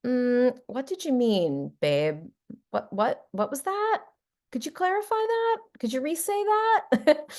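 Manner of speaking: asking a partner to clarify in a hammed-up curious voice; exaggerated curiosity. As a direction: play up the sweet, curious partner. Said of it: "I will do my very best to use curiosity".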